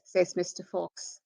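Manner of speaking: ordinary delivery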